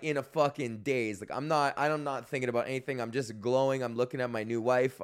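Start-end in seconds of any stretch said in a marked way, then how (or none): none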